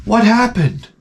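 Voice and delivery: high, gasping nosy coworker. Retold or imitated. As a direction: ape the gawking coworkers. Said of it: "The next one comes up".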